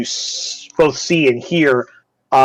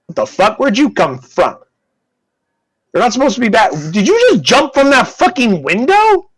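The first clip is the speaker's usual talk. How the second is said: high-pitched, disbelieving acted reaction; incredulous outburst; 9 semitones higher than usual. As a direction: voice shoots up in baffled disbelief. Startled individual confronts someone back from jump.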